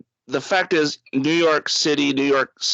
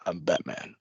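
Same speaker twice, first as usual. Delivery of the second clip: much lower and much softer, like gargling battery acid; Batman growl; 8 semitones lower, 10 dB quieter than usual. Retold or imitated. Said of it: "talk like he was just gargling battery acid".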